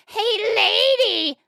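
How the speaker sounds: high, drawn-out parrot squawk. Acted. Parrot hailing a passing lady, high squawking voice. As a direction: call out like a cheeky parrot. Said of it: "the parrot says".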